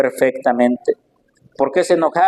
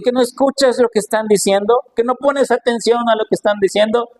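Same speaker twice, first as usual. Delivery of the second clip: higher-pitched indignant questioning; acted indignation, pitched up; indignant scribes; 7 semitones higher than usual. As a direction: challenge him as offended scribes. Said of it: "se indignan y le dicen".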